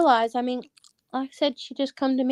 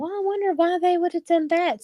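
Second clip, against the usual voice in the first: mock-innocent wondering; 8 semitones higher than usual. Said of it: "And I thought".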